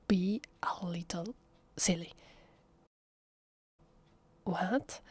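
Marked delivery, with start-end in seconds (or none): none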